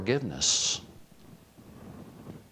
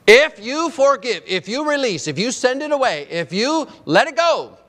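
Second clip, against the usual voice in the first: higher, climbing sermon cadence; preaching build; 12 semitones higher, 6 dB louder than usual. Acted. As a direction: stack each clause higher.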